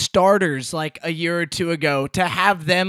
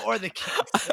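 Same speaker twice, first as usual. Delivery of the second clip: laughing; 3 dB quieter, slower than usual. Real, not acted. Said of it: "chuckled repeat".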